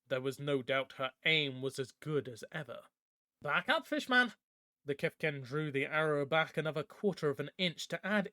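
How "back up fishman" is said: high, loud threat at bow point; sharp command. Acted. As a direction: order him back, sharp and high. Bridget threatens Sig at arrowpoint.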